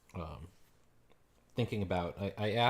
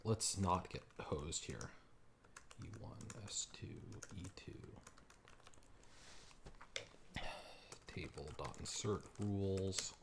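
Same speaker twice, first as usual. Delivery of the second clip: muttering to himself; 13 dB quieter, slower than usual. Real, not acted. Muttering code while typing.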